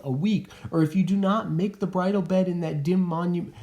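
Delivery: a daughter begging her mother, pleading; pleading Juliet. Acted. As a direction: beg your mother not to cast you away. Teacher reads Juliet's plea.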